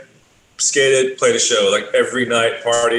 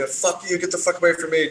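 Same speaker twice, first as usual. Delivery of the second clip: imitating Phelps; 7 semitones higher, 4 dB quieter than usual. Phelps telling his harasser to back off, imitated crankiness. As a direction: play a cranky man warning someone off. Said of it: "just how Phelps is".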